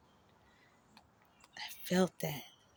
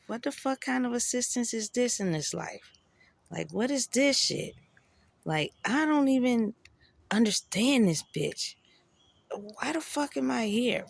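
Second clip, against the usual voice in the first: retold anger at God; 6 semitones higher than usual. Railing at God.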